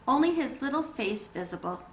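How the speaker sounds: usual speaking voice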